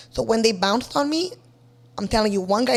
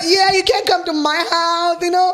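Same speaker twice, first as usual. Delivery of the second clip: much higher and louder with whoops; excited shouting; 7 semitones higher, 5 dB louder than usual. Real, not acted. Telling friend about bringing booty calls home, gleeful whooping. Celebrating the back door.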